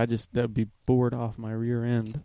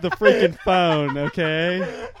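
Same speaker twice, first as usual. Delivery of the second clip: mock annoyed; 5 semitones higher, 5 dB louder, slower than usual. Acts out the annoyed salon woman.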